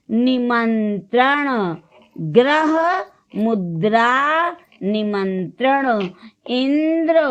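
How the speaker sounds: measured model reading, word by word; word drill. Shown as a demonstration. Teacher reading a word list to learners, word drill. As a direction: stretch each word out for the class to follow.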